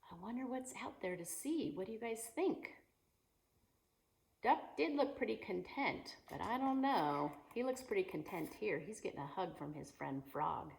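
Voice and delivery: ordinary delivery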